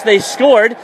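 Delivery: normal talking voice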